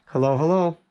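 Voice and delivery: ordinary talk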